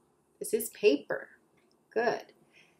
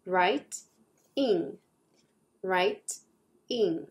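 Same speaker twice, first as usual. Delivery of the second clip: Write and ing apart with a pause; split into syllables; 3 semitones lower, much slower than usual. Shown as a demonstration. Teacher to kids, sounding out writing in two beats. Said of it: "two syllables, two sounds".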